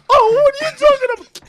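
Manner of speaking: high, loud mock girlfriend. Friend imitating girlfriend denying, high and loud. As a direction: whine it high.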